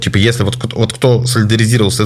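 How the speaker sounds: usual speaking voice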